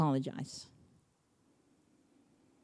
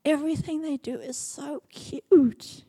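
doting grandparent; 8 semitones higher, 3 dB louder than usual. Retold or imitated. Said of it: "you see them and you go".

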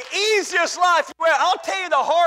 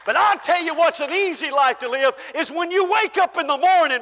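usual speaking voice

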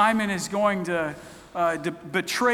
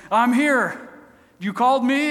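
put-on higher, louder boy calling out; eager young Samuel; 6 semitones higher, 6 dB louder than usual. Boy running into the old priest's room, eager call. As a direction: burst in and announce yourself.

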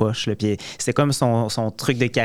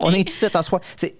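put-on playful Acadian accent, higher; a mock Acadian stage greeting; 7 semitones higher than usual. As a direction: announce the show in thick Acadian. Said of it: "Il voulait juste faire son accent acadien".

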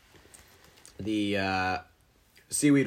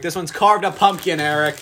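excited; 5 semitones higher, 8 dB louder than usual. Real, not acted. Excited pointing at cookies.

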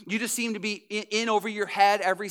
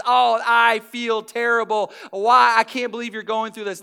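mock sympathy; 7 dB louder than usual. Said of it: "pours in and says".

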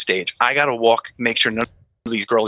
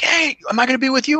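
friendly question; 12 semitones higher than usual. Retold or imitated. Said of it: "So he's like".

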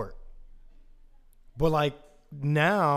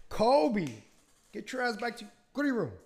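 calling out; 8 semitones higher than usual. Real, not acted. Calling the dog.

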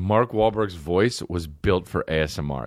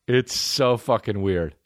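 ordinary talk

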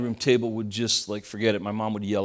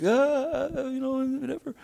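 put-on much higher, offhand girl's voice; mock-nonchalant daughter; 12 semitones higher, 4 dB quieter than usual. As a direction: play it off like a shrugging kid. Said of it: "she's like".

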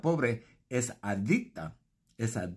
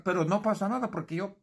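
ordinary talk, nothing marked

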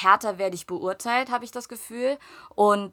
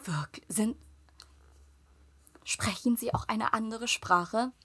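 much softer, whispered, slowed; whispered question; 10 dB quieter, slower than usual. Retold or imitated. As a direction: ask in a cautious whisper. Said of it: "Und sie nur". (flüsternd und langsam gefragt)

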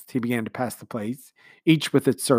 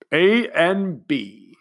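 grand, slow speech-making commander; commander's speech; 7 semitones higher, 7 dB louder, much slower than usual. A narrator's reading. Commander speechifying to the household, sentimental. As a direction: speak like a sentimental host.

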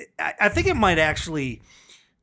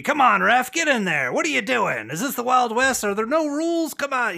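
louder, higher mock crowd heckle at the referee; heckling yell at ref; 9 semitones higher, 4 dB louder than usual. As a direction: bellow it at the ref like a fan in the stands. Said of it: "by yelling at the referee".

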